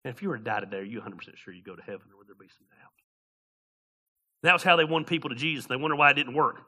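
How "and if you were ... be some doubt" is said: soft mock door-to-door pitch, much quieter; preacher mocking his old sales pitch to a stranger; soul-winner's pitch. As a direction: lightly play the eager caller at a stranger's door. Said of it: "no relationship with this person".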